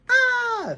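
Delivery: very high, loud acted scream. A narrator's reading. John reacting to a loud howl, screaming. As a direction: scream out like a frightened story character. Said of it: "screamed John".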